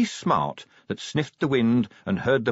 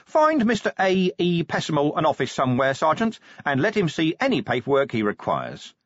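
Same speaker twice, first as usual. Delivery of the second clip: higher-pitched, put-on helpfulness; fake joviality; 7 semitones higher than usual. A narrator's reading. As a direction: order it with forced good cheer. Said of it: "he said with fake joviality".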